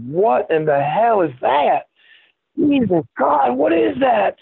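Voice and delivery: imitated Southern drawl, pitched high and slow. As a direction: mimic a Southern biker, amazed. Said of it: "he had that big Southern drawl".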